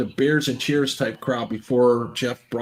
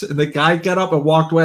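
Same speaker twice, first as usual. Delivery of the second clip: ordinary delivery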